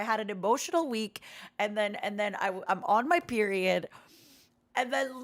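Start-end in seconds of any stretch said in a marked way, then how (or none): none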